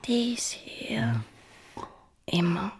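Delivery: slow, possessive whisper; queen whispering a binding vow to her lover; intense whisper. Acted. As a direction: whisper the vow slowly and deeply.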